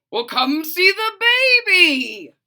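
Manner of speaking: loud happy call. Acted. Father calls Froggy to see the baby, loud. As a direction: call him over, loud.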